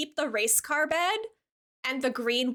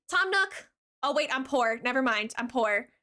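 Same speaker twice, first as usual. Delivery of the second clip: usual speaking voice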